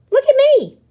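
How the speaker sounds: very high, loud delighted squeal; a thrilled little shout; showing off. Acted. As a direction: show off to everyone. Pip showing off the fuzz.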